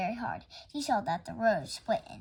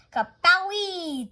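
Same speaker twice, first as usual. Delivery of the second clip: loud, high, slow bang sound; tire blowout boom; 8 semitones higher, 8 dB louder, much slower than usual. A narrator's reading. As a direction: burst it out like a blowout. Tire bursting sound effect.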